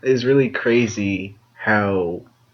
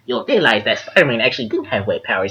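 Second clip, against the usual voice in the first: know-it-all nerd correcting; 3 semitones higher than usual. Retold or imitated. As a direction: pedantic comic nerd. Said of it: "That's my nerd voice".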